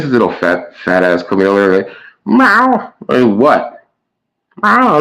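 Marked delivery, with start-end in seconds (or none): 2.3-2.9 high mewing cat voice; much higher, imitating a cat; cat meow. Imitating her cat asking for food. 4.6-5.0 high mewing cat voice; much higher, imitating a cat; cat meow. Imitating her cat asking for food.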